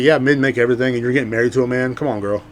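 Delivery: normal talking voice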